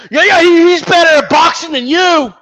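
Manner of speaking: high, loud mocking fanboy voice; hammed-up fanboy taunt. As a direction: taunt like a smug fan.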